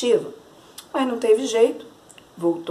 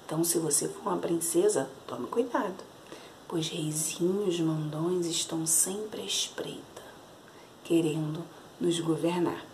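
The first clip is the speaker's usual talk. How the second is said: hushed warning; 4 semitones lower, 10 dB quieter than usual. A narrator's reading. Storyteller's warning to listeners.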